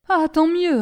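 high, loud sigh of relief in words; relieved outburst. A narrator's reading. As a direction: exclaim as if freed of a weight. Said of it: "comme délivré d'un poids écrasant".

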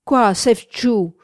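loud, indignant cry; shouted retort. A narrator's reading. Man rounding on the timid young woman, shouting. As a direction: throw it back loudly, drawn out. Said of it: "cria-t-il".

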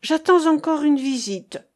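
high, emphatic exclamation; exclaiming. A narrator's reading. Marianne expecting a visitor, exclaiming. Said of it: "s'écria-t-elle".

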